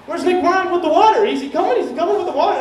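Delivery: high, frantic retold questioning; manic pestering. Manic friend asking cops about the water, high frantic questioning. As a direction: imitate an over-excited friend demanding answers.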